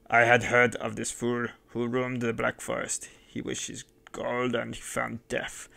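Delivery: a lightly voiced mad innkeeper savouring revenge; menacing madman. A narrator's reading. Mad host gloats over the dead bandit, menacing.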